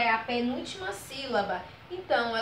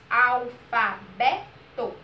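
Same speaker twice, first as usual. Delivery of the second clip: ALFABETO very slow in four beats, AL-FA-BE-TO; teacher slowly sounding out ALFABETO; syllable by syllable; 4 dB louder, much slower than usual. Shown as a demonstration. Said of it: "a gente abriu a boca quatro vezes".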